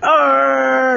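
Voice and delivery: high, drawn-out mock pirate cry; a boy's playful pirate roar back; pirate arr. Boy answers pirate's roar.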